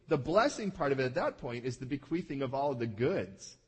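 usual speaking voice

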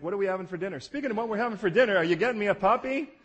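put-on high, loud, fast begging kid voice; pestering child. Daughter pestering dad for a puppy, high pleading. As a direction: nag for a puppy out of nowhere. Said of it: "she gets in these moods".

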